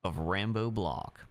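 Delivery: reading his own crime story aloud; story narration. Acted. Reading his crime book aloud to the court, in a story voice. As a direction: narrate it like an audiobook. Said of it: "you should narrate books".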